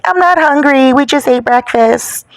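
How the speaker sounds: high-pitched mock complaint; imitated whining. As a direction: mimic her whiny protest. Mimics upset woman refusing.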